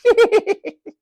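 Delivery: high, loud gloating laugh; matron's cruel cackle. A narrator's reading. Matron laughing at her own jibe, high cackle. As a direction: cackle alone at your joke. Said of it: "no one laughed except Matron".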